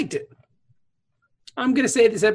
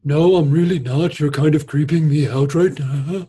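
Cisco voice; 3 semitones lower, 3 dB louder than usual. Retold or imitated. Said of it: "Cisco's like".

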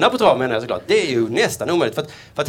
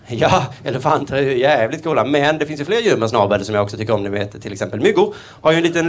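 ordinary delivery

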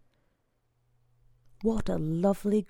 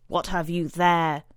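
stern demand; 4 dB louder than usual. A narrator's reading. Brother demands to see box.

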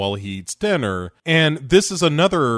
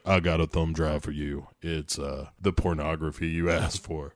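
voicing Balrog; 9 semitones lower, 8 dB quieter than usual. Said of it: "is just like".

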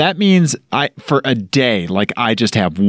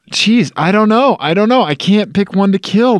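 anguished dithering; 8 semitones higher than usual. Real, not acted. Agonising over which car to kill.

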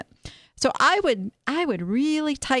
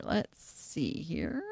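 muttering to herself; 5 semitones lower, 11 dB quieter, much slower than usual. Real, not acted. Host browsing next listing.

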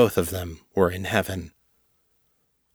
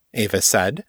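ordinary delivery

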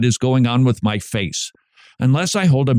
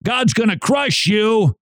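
hammed-up strong word of warning; mock stern warning; 7 semitones higher than usual. Host mimicking an overzealous rebuker, mock stern warning. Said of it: "people need a really strong word".

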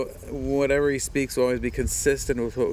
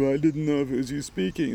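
lightly mocked excuse-maker, pitched up; lame excuse; 4 semitones higher than usual. Man excusing himself to God in heaven, mildly mocked. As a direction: offer a feeble excuse, mildly put on. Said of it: "as opposed to standing there going".

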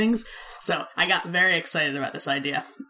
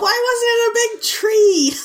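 incredulous; 14 semitones higher, 5 dB louder, slower than usual. Real, not acted. Incredulous at Ewok village toy.

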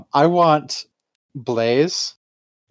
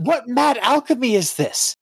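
amazed exclamation; 8 semitones higher than usual. Acted. Character marvels at the dish.

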